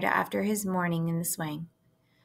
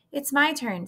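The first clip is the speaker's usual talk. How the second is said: put-on impatient girl; 7 semitones higher than usual. Fern demands her turn.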